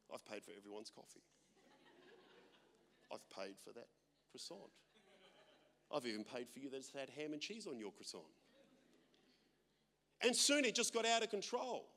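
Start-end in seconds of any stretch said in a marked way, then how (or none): 0.1-8.3 much softer smug bragging voice; mock boasting. Pastor mimicking café boasters, softly smug. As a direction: preen like a show-off buyer. Mocking status-seeking coffee buyers.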